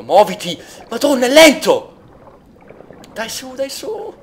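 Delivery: high frantic urging; panicked urging. Real, not acted. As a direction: panic as the air runs out. Drowning, urging character up.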